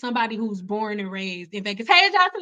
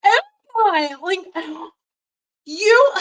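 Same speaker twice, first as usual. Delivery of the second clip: excited; 8 semitones higher, 7 dB louder, slower than usual. Retold or imitated. Girls' excited welcome, high.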